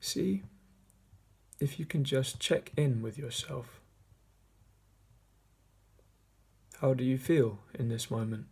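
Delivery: measured meditation voice with long pauses; guided meditation. Acted. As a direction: guide listeners gently inward, leaving long silences between phrases. Leads a meditation.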